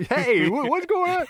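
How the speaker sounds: sudden high squeak of alarm; shrill, startled and confused; startled high cry. Retold or imitated. Voicing the startled boat owners.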